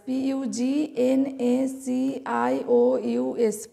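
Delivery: slow, letter-by-letter spelling. Shown as a demonstration. Teacher spelling a long word for students, slow spelling. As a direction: spell it out slowly, one letter at a time.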